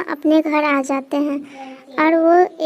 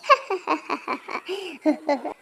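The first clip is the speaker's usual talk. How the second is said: quiet eerie cackle; breathy evil laugh; soft witch cackle; 7 dB quieter than usual. Acted. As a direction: cackle softly like a ghost.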